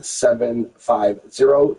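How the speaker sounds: slow, careful digit readback. Real, not acted. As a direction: spell out each digit slowly. Agent reads back second number.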